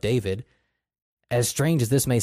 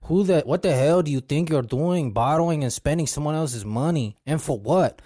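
put-on Spanish accent; 6 semitones higher than usual. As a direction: scold him in a thick Spanish accent. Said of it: "In his thick Spanish accent".

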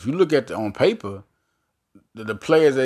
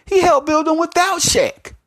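much higher and louder, stress on he; pitched-up triumphant emphasis; excited; 6 dB louder than usual. Real, not acted. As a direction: hammer home the comeback point. Punchline of the Shaq argument.

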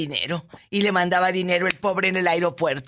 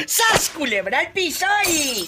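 very high mock-shocked squeal; theatrical scandalized cry; catchphrase shriek; 12 semitones higher than usual. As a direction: squeal the catchphrase at the top of your register. Host shouts mock-shocked catchphrase.

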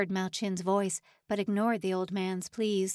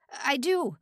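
blurted; 6 semitones higher, 4 dB louder than usual. A narrator's reading. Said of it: "Simon blurted".

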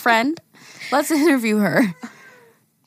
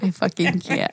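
ordinary delivery

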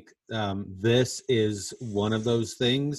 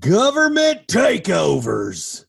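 high, loud, drawn-out proclamation; mock-dramatic announcement; 6 semitones higher, 7 dB louder, slower than usual. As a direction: announce it big and slow.

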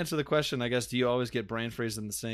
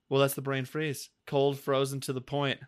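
ordinary talk

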